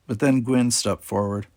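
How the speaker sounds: ordinary delivery, nothing marked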